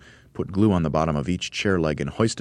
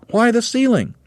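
very high incredulous question; astonished cry; 7 dB louder than usual. A narrator's reading. Monkeys to leader about the ceiling, astonished cry. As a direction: squeal it high and loud, baffled. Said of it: "they cried".

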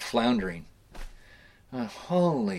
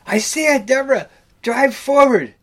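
high, loud imitated skipper's orders; hammed-up captain barking from the bow; retold skipper shouting to the wheelhouse; excited skipper; 10 dB louder than usual. Skipper yells orders to wife.

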